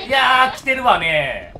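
loud, high yell; panicked shout. Real, not acted. Gamer yelling as the monster chases him. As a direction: cry out in alarm.

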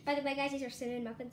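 very fast throwaway aside; quick hurried mention; rushed aside. Real, not acted. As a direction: rattle it off quickly to the audience.

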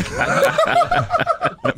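high burst of real laughter. Real, not acted. Host laughing at his own cheeky remark about the band. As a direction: break into laughter mid-sentence.

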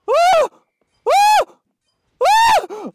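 very high, very loud screams; piercing screams. Real, not acted. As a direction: scream at the top of your range. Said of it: "Why am I screaming so loud?".

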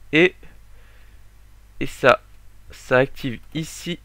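normal talking voice

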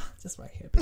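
whispered snide aside. Retold or imitated. To the customer, a whispered snide aside. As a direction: breathe it out under your breath. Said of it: "I was like".

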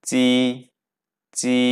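tongue flat on the palate for চি; Mandarin ji sound. Shown as a demonstration. Teacher contrasts Chinese j with Bengali চ. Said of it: "জের উচ্চারণ পড়েছিলাম চয়ের মতো".

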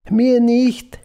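high, loud wail in narration; sobbing, acted. A narrator's reading. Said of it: "schluchzte sie".

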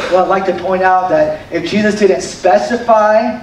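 usual speaking voice

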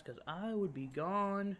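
high, drawn-out "gone"; exaggerated insistence. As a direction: stretch it upward for comic emphasis. Exaggerates how fast he would flee.

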